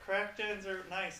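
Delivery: usual speaking voice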